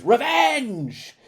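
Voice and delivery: high, drawn-out theatrical shriek; ghost's scream. Acted. Podcaster imitating a TV musical's angry ghost, hammed-up scream. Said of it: "A, a ghost that screams".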